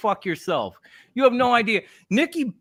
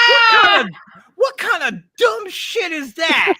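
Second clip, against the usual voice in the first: laughing outburst; 11 semitones higher, 8 dB louder than usual. Real, not acted. Reacting to his own bit.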